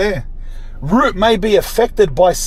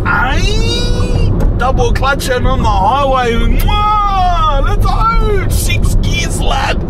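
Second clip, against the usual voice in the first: hyped up; 9 semitones higher, much slower than usual. Real, not acted. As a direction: crow about the car like a racer. Hyped racing on the highway.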